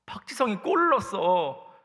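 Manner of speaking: high excited mock yell; imitated frenzy. Friends calling out Park Ji-sung's goal, imitated excitement. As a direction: act out friends going wild over a goal. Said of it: "친구들이 난리가 났어요".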